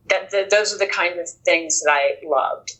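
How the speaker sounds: normal talking voice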